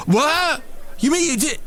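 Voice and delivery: very high, exaggerated disbelief; mock shock. Mimicking people astonished at what he missed, mock shock. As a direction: squeak in cartoonish astonishment.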